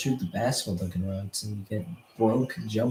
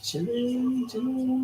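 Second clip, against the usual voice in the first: high mocking; 14 semitones higher, slower than usual. Mocking a repeated quote.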